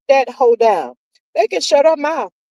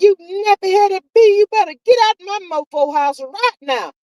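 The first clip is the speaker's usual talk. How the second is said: mock screaming; 7 semitones higher than usual. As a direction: shout her out of the house, half acting. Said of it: "screaming and carrying on".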